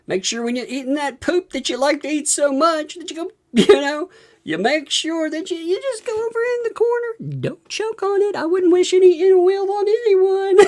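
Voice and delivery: very high, strained mock-concerned voice; mocking advice. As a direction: taunt him in a high voice, breaking into laughter. Mocking advice he'd have given him.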